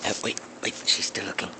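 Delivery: tense whisper. Real, not acted. Hiding from enemy, whispering.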